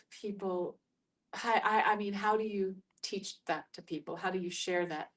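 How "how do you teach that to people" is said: ordinary delivery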